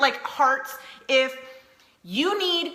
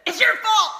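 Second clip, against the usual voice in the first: mock blaming yell; 8 semitones higher, 6 dB louder than usual. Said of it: "You go from embarrassment to".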